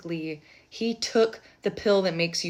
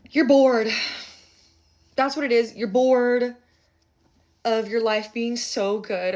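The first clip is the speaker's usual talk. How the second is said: loud, high teasing call-out; mocking scold; 5 semitones higher, 7 dB louder than usual. Tarot reader loudly teasing a bored viewer. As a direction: tease them loudly for being bored.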